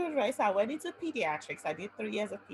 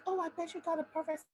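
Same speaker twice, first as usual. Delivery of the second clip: teasing mentor; 7 semitones higher than usual. Retold or imitated. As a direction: tease her with a sly higher voice. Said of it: "when she was like".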